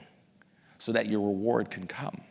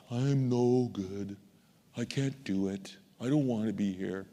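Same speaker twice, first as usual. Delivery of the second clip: slow, softening, defeated mumble; dejected; 2 semitones lower, 4 dB quieter, slower than usual. Acted. Someone corrected in public shutting down, dejected. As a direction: act out someone giving up, slow and subdued.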